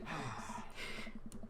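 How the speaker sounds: long, soft breathy sigh; weary sigh. Acted. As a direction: let out a long resigned breath. Weary after burnt yard story.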